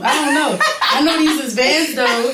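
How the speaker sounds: high, loud, laughing voice; imitating Tubs. Guest mimics Tubs asked about her outfit, high and laughing. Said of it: "And Tubs go".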